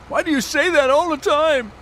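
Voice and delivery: mock his whiny complaint in a high squeak; mocking annoyed friend. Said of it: "he got mad at me".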